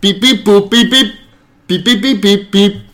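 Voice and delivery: imitated phone keypad tones; short mouth-made dial tones; dialling beeps. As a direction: beep out each button press. Voices the phone being dialled.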